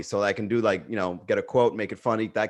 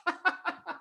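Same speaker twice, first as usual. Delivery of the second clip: a quick, high burst of laughter; 7 dB quieter than usual. Real, not acted. Host laughs about his lookalike's ad spend, high laugh. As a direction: laugh out at his own joke.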